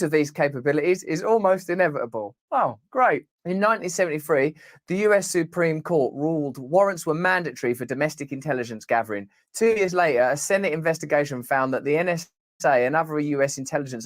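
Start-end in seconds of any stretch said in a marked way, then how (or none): none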